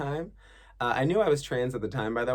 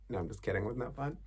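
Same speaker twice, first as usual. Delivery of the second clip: ordinary talk, nothing marked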